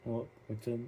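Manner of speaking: ordinary delivery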